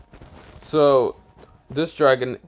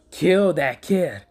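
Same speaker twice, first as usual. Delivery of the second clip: higher, slow, put-on villain voice; villain order; 6 semitones higher, slower than usual. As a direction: command it slowly like a cartoon villain. Villain orders snake to kill.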